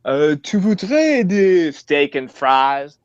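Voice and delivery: mock French accent. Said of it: "mock French waiter".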